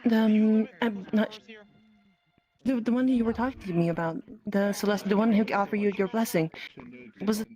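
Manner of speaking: ordinary delivery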